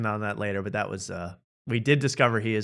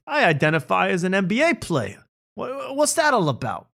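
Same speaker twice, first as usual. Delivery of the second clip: high-pitched incredulous comic delivery; mock stand-up bit; 6 semitones higher than usual. Co-host parodying the comedian's tired identity jokes, high mock stand-up voice. Mocking the comedian's old joke style.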